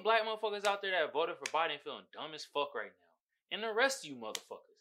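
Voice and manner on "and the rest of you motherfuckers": ordinary delivery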